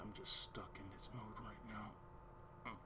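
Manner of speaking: slow, breath-only whisper; whispered explanation. Retold or imitated. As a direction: whisper it haltingly, as if stuck. Retold answer to Damon.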